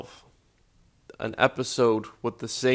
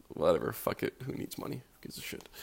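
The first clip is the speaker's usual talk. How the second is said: muttered aside; 9 dB quieter than usual. Real, not acted. Muttered aside about money.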